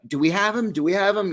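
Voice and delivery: the CIA director anxiously pressing for news; eager director. Retold or imitated. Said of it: "he's like".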